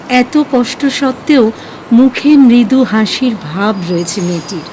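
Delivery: normal talking voice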